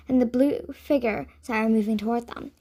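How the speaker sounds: ordinary delivery, nothing marked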